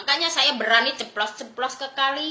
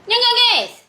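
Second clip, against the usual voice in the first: loud, high call to the viewers; 9 semitones higher, 6 dB louder than usual. Real, not acted. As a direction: call it out louder and higher, pressing for agreement. Calling out to livestream viewers. (seruan lantang bernada tinggi)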